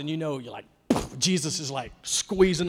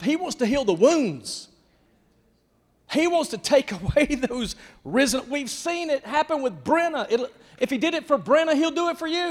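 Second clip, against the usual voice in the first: high, loud preaching build; fired-up preacher promising healing; fiery preaching; 8 semitones higher, 7 dB louder than usual. Acted. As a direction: preach it with rising fire. Preaching at full force.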